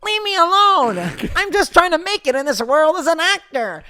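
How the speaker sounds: high, very loud mock wailing; hammed-up bullying scene. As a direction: shriek it like a melodramatic bullied kid. Acting out bullying and bullied friend.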